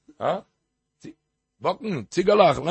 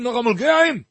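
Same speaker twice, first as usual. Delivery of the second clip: retold shout; 7 semitones higher, 4 dB louder than usual. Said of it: "Hat er geschrien".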